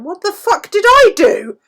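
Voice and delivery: high, loud indignant dog voice; voicing the dog. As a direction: squeal out the dog's outrage in a put-on squeaky voice. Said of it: "She's lying there going".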